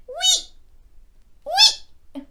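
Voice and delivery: high long sliding whee; playful whee. Pretend. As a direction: ride down the slide with glee. More slide cries.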